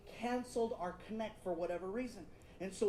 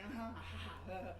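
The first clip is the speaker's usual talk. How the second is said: jaded; 3 semitones lower, 8 dB quieter than usual. Retold or imitated. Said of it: "she's jaded".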